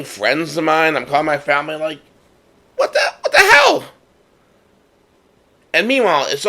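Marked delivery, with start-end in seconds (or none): 2.8-3.8 high, loud mock outburst of disbelief; exasperated outburst. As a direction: throw it up high like you can't believe it. Retelling calls to friends, upset.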